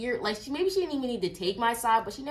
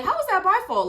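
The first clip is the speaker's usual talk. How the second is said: higher and louder, a mock-indignant protest; incredulous retort; 11 semitones higher, 6 dB louder than usual. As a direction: toss it back like it's absurd. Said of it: "Which I'm just like".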